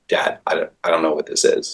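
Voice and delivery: lightly voiced kid, hushed and confused; half-whispered mock child; quiet puzzled kid. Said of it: "like, going".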